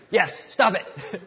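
loud, high, slow telling-off; mock exasperated scolding. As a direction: scold a child with comic exasperation. Uncle scolds niece in story.

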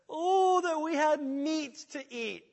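high, loud, slow whine for meat; whining Israelites. Acted. Preacher voicing Israelites, high and whiny. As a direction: wail it like spoiled complainers.

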